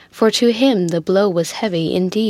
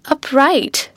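put-on higher, incredulous echo in Biddy's accent; Biddy's customary accent; 6 semitones higher than usual. A narrator's reading. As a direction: repeat it in a lightly accented maid's voice. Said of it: "repeated Biddy in her customary accent".

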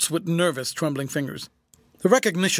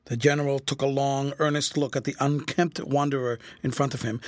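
usual speaking voice

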